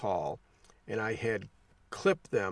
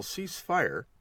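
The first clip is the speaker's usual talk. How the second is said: higher-pitched call, not full volume; retold yell; 7 semitones higher than usual. Instructor halting shooters as a woman nears, retold yell. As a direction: imitate yelling a range command. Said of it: "I had to yell".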